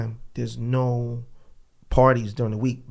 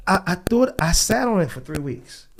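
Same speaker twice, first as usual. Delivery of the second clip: ordinary delivery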